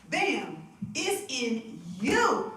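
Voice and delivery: ordinary talk, nothing marked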